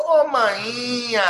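imitating a singer's long holler. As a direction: call it out high and loud, stretching the vowels. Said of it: "compadre [name] gritando".

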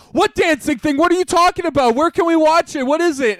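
very high, loud volley of questions; clamouring crowd. Retold or imitated. Everyone in the Zoom call asks at once, clamouring. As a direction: imitate a whole group demanding answers.

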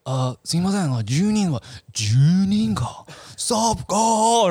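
high, loud mock greeting in broken Japanese; movie character impression. Retelling a movie scene to friends, high hammed-up voice. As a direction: ham it up like a movie extra. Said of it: "And he's like".

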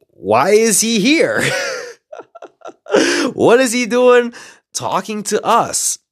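high-pitched, drawn-out bafflement; incredulous questions, stretched out for comic effect; confused. Retold or imitated. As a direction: act out a baffled group, loud and high. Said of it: "my group of people are confused".

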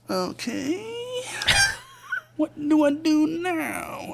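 high, slow panicked squeak with a laugh; laughing mock despair. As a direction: play the panic of being locked out. Panicking at a locked door.

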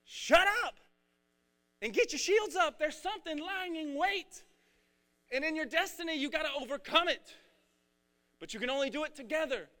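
high, loud, urgent command; commanding shout. Retold or imitated. God ordering the brothers to raise shields, shouting. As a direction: bark it like a battle order.

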